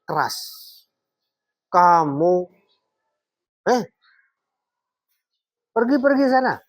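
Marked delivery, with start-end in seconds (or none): 1.7-6.6 loud, high-pitched scolding; demonstrated angry dismissal; harsh high intonation. Shown as a demonstration. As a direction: send them away with sharp, raised commands. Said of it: "Nada bicaranya keras".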